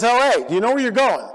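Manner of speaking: imitating an animated phone caller; retold excited friend. Friend calls to tease the speaker about his destination, excited.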